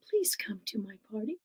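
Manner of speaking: soft whispered plea; little girl whispering. Acted. Little girl invites the witch in a whisper.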